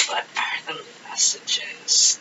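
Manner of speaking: slow, soft whisper; hushed and drawn out, to yourself; whispered question. Real, not acted. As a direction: whisper it to the cards. Murmuring the question while shuffling cards.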